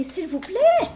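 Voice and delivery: high, loud desperate begging; pleading voice. Pretend. Reader voicing the absent lover begging forgiveness, pleading high and loud.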